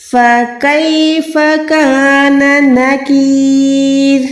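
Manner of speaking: slow, measured tajweed recitation, vowels held long; Quran recitation. Shown as a demonstration. Teacher models the verse for students, slow recitation. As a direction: chant the verse on a sustained even tone, very slowly.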